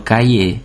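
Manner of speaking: cahier starting with a K sound; French cahier, hard C, silent H. Shown as a demonstration. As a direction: say cahier as "ka-yay". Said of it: "néha K-nak ejtjük".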